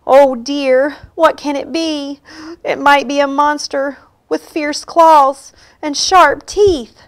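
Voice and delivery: high, alarmed animal voices; frightened. A narrator's reading. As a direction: fret aloud in a high, worried pitch. Frightened animals imagine a monster.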